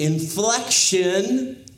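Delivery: "inflection" drawn out with exaggerated pitch movement; slow, emphatic swing of the voice on "inflection"; stress by inflection. Shown as a demonstration. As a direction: lean hard on the word "inflection", stretching it. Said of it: "like I just did".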